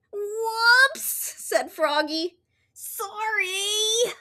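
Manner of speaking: high, sheepish voice; Froggy. Acted. Froggy apologizes to the librarian, high and sheepish. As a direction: apologize meekly.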